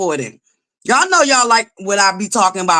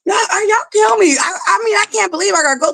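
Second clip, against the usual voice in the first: high, raised voice; exasperated shout; 11 semitones higher than usual. Real, not acted. Streamer scolds viewers over missing likes, high shout. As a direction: holler up high in disbelief.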